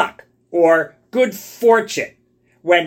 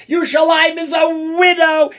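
wailing lament; 7 semitones higher than usual. Acted. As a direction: wail the verse as a weeping prophet. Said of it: "He's, he's bawling his eyes out".

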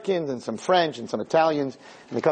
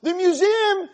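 preaching. Acted. Rabbi preaching to the man that God is not on trial. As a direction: preach it out with full force.

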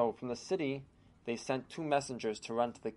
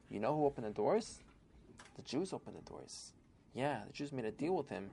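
much softer, gossiping under the breath; hushed rumor; 9 dB quieter than usual. Retold or imitated. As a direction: spread it like a dangerous secret. Townspeople spreading a hushed rumor.

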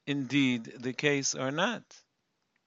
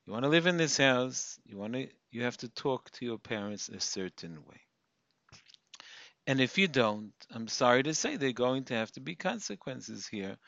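softer, calm parental firmness; 4 semitones lower, 6 dB quieter than usual. Shown as a demonstration. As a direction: set the rule without anger. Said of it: "We'll be calm".

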